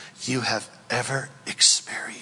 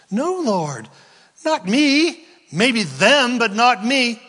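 much higher and louder, insistent; indignant protest; 10 semitones higher, 6 dB louder, slower than usual. Acted. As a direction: deny it heatedly. Peter protests to the Lord.

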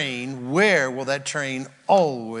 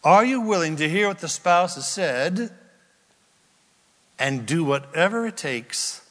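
ordinary talk

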